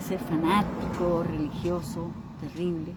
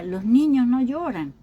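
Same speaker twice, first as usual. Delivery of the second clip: raised, firm voice of a parent forbidding tears; stern parental scolding; 5 semitones higher, 7 dB louder than usual. Retold or imitated. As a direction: scold the boy: boys don't cry. Parents scolding a crying boy. (padre regañando con firmeza)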